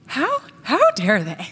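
slow, outraged inner voice; hammed-up pregnant outrage; mock indignation. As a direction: drag out the offended how dare they. I was like.

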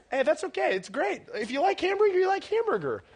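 high-pitched teasing reassurance; mock reassurance. Youth pastor teasing a student who likes hamburger, mock reassurance. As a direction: humor them in a high voice.

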